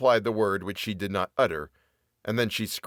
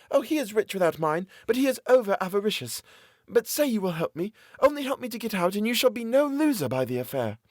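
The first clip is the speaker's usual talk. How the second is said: much higher, desperate woman begging; pleading captive woman; 11 semitones higher than usual. A narrator's reading. Captive woman pleading with her jailer for mercy. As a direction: beg for mercy in a high woman's voice.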